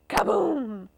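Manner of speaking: booming comic "kaboom"; punchy sound effect; explosive crash. A narrator's reading. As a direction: imitate a crash with a drawn-out boom. Rider crashes on his farm.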